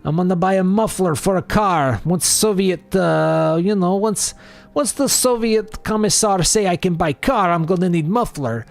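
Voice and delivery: high-pitched hammed-up Russian accent; squeaky pretend Russian voice; mock Russian accent. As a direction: play a silly Soviet shopper, high Russian accent. Playful accented bit about spending rubles.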